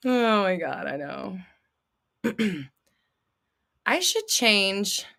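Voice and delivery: usual speaking voice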